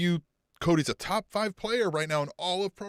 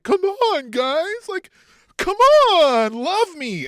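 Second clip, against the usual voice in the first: high, loud whiny plea, slowing at the end; mock pleading; 13 semitones higher, 9 dB louder, much slower than usual. Mocking Cody begging the crowd, high and whiny. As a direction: beg the crowd to love you.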